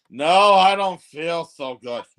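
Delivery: slow, drowsy, groggy voice of a sick driver; sick friend. Retold or imitated. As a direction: drag the words out like you're about to pass out. Friend feels sick while driving.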